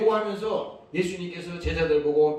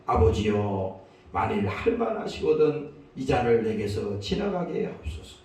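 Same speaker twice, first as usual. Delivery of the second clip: low plea swelling to loud, slow surrender; solemn prayer; 3 semitones lower, 3 dB louder, slower than usual. Acted. Jesus prays in Gethsemane to the Father, solemn plea. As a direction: implore the Father, drawing out the final phrases. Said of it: "겟세마네에서 이 기도를".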